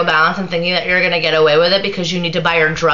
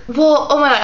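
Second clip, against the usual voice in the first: pitched up high, imitating her panic; a higher mock voice, mocking her fluster; mock flustered girl; 8 semitones higher than usual. Mocking the girl's flustered reply.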